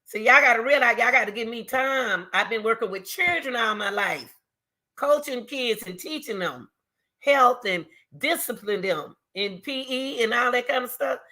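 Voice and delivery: ordinary talk, nothing marked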